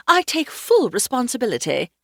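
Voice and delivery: high, put-on upper-crust English voice; upper crust English accent. A narrator's reading. Julia takes the blame for the weather, upper crust English accent. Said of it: "in an upper crust English accent".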